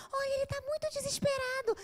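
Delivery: very high, thin friend's voice; worried friend. Retold or imitated. Friend reports boyfriend desperate.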